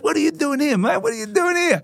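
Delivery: very high-pitched, delighted surprise; excited greeting. Retold or imitated. Player bear-hugs a friend from behind, excitedly surprised. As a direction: imitate a mate squealing his hello.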